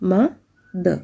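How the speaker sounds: "ma, the" as two separate beats; mother said syllable by syllable; split into syllables. Shown as a demonstration. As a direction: break mother into "ma" and "the". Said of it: "രണ്ട് syllables വരുന്നുണ്ട്".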